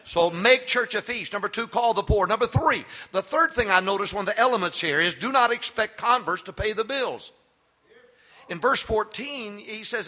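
loud, high preaching at full force. Acted. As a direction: preach it out to the congregation.

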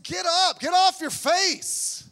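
loud, high shout, drawn out at the end; hammed-up divine command. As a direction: yell the order at someone on the ground. Acts God ordering Joshua up.

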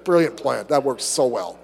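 ordinary delivery

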